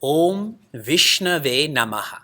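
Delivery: Om Vishnave Namaha drawn out slowly; slow deliberate recitation; slow mantra demo. Shown as a demonstration. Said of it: "And this mantra is".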